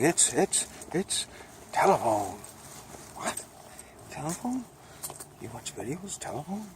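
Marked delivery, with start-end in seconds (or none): 3.1-6.2 high, incredulous "Telephone?" on a sharp rise; mock disbelief. Mock old-timer surprised at phones. 6.2-6.6 high, incredulous "Telephone?" on a sharp rise; mock disbelief. Mock old-timer surprised at phones.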